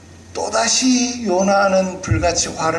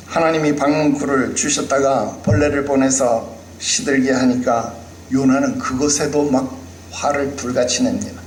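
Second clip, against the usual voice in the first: normal talking voice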